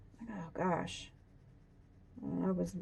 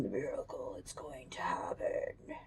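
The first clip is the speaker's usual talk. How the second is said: scary voice; 8 semitones lower, 11 dB quieter than usual. Acted. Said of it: "I got scary voice all of a sudden".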